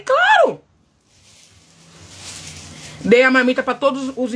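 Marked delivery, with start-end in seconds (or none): 0.0-0.6 bright high agreement. Retold or imitated. Agreeing to give him food.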